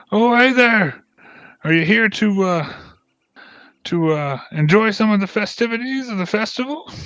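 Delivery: high, cheery shouted greeting; jolly dwarf. Acted. Dwarf hailing the party from across the pen, jolly and high. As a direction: call out like a beaming festival host.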